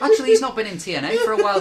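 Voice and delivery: fast, high, laughing. Real, not acted. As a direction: blurt it through laughter.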